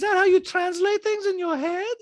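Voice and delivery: loud, high put-on voice; Diana. Diana challenging Sutton's logic, high mock impression. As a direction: mimic her questioning up high. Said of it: "Diana's like".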